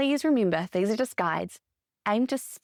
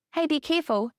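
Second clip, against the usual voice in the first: higher-pitched, falling caution; acted parent's heads-up, pitched up; parent cautioning child; 7 semitones higher than usual. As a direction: lightly call a caution to a child.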